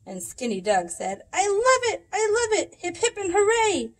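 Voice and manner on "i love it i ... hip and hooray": high, loud, delighted cheering; squealing with delight; excited cheer. A narrator's reading. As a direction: cheer it like a thrilled kid. Skinny Doug's excited cheer.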